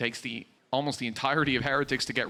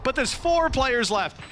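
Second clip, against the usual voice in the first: louder, higher hype call; esports caster; 6 semitones higher, 3 dB louder than usual. Acted. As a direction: ride the clutch with rising energy. Live play-by-play casting.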